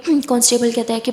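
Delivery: ordinary delivery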